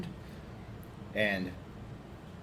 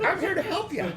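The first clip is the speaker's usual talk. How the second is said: high, sly crone voice; evil old lady voice. Acted. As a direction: offer the deal like a scheming witch. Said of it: "She's like".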